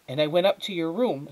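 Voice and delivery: high-voiced mother, worked up; upset mother. Mother tells Flora of the empty room, upset.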